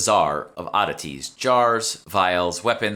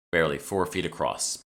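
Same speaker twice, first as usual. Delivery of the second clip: plain reading voice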